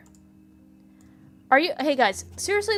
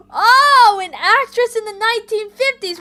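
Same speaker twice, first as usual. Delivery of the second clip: much higher and louder, bursting with excitement; thrilled; 10 semitones higher, 11 dB louder than usual. Real, not acted. Streamer reading a thrilling discovery, higher and louder. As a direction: blurt it out, delighted by the find.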